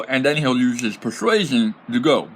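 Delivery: usual speaking voice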